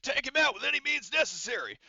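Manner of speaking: high, loud, over-the-top command; strained, hammed-up battle command; commanding villain order. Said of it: "he's like".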